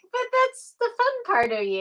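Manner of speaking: high-pitched, animated retold reply; high, playful protest; mock reassurance. Acted reply to self-conscious friends.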